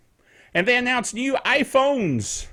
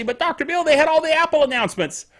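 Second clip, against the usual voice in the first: lightly voiced protesting listener, higher; imagined listener; 7 semitones higher, faster than usual. Listener objects about the Apple announcements, imagined protest. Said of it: "Now you might say".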